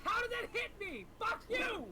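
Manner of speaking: shouting in frustration; raging gamer. Real, not acted. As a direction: yell it at the screen, furious. Getting hit by boss.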